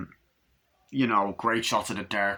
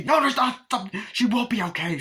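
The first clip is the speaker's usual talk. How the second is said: desperate protest; 12 semitones higher, 4 dB louder than usual. Retold or imitated. Mother argues, desperate.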